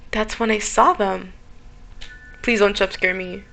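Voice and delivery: normal talking voice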